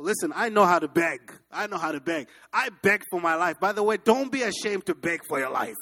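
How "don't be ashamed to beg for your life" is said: ordinary talk, nothing marked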